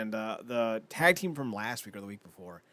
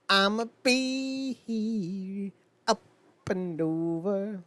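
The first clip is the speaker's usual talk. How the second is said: drawn-out sing-song; 7 semitones higher, much slower than usual. Real, not acted.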